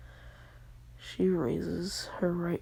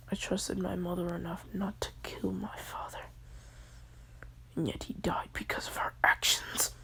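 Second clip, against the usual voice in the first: hushed story told in a whisper; whispered storytelling; 4 dB quieter than usual. Acted. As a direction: whisper the whole tale close to the mic. Whispered retelling of anime fight.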